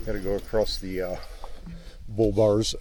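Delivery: riding over cattle guard bumps; on a bumpy road. Real, not acted. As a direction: voice jolted by bumps. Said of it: "sorry about the bumps there".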